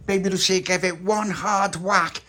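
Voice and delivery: ordinary delivery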